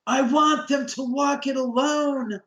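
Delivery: high, slow, drawn-out yell; imitated booming call. Director calling up the driveway to the crew, booming yell. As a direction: call it out over a distance, stretching the words. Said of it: "booming, yelling voice".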